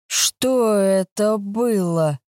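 slow, drawn-out sleepy question. A narrator's reading. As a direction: stretch the words out, dazed. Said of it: "протянул Шуруп".

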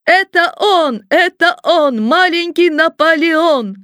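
put-on high-pitched chanting cry of excited kids; children's chorus shout. A narrator's reading. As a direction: call it out like a crowd of children, drawing out the end. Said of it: "все дети вдруг хором закричали".

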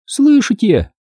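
put-on high girl's call; excited cry. A narrator's reading. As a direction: ask urgently, pitched right up. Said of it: "закричала Минди". (взволнованный вскрик девочки)

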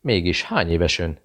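plain reading voice

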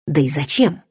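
usual speaking voice

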